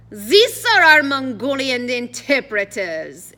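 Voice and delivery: put-on loud, slow, hammy French accent; grand French-accented introduction, pitched high; exaggerated French accent. A narrator's reading. Addison introducing nobody to the guard, exaggerated French accent. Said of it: "speaking in an exaggerated French accent".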